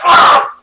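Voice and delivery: short, loud mock yell; retold frustrated yell. Gamer recalling sticky keys getting in the way, mock yell. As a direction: act out a burst of frustration.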